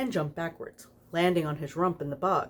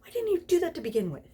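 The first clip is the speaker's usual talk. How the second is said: much higher and fast, indignant; indignant squeal; 13 semitones higher, faster than usual. Acted. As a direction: fire it off in a huff. Aziraphale indignant outburst.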